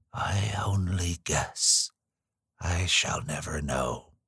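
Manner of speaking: slow whispery old woman, barely voiced; cracked little voice. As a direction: breathe it out like a frail hundred-year-old nurse. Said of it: "in her cracked little voice".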